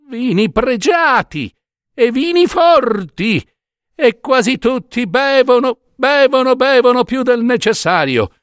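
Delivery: high-pitched indignation, building on each repeat; indignant old woman. A narrator's reading. Old washerwoman's friend railing at the judge's hypocrisy, indignant. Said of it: "si era arrabbiata".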